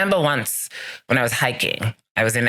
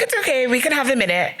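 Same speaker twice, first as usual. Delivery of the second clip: higher, quicker mock friend voice; reassuring friend; 10 semitones higher, faster than usual. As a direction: lightly act the hiking buddy, higher and brisk. Friend reassures out-of-breath hiker.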